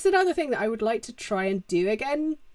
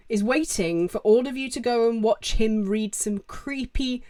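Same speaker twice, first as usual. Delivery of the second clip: ordinary delivery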